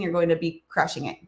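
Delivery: normal talking voice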